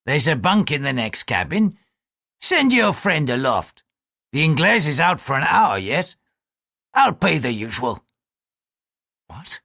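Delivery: coarse sailor, leaning in with a leer; a crude seaman sizing her up, pushy; leering bosun. Acted. Sailor propositioning a lady, leering and pushy.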